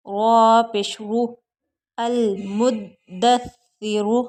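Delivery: slow, loud letter-by-letter recitation; slow spelling drill. Shown as a demonstration. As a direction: spell each syllable out slowly and firmly. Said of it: "spelling drill".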